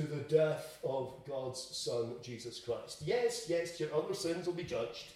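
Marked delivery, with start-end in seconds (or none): none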